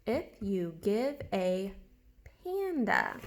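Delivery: slow read-back, word by word; slow reading aloud. Shown as a demonstration. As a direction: read it back slowly and evenly. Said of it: "let's read it to make sure".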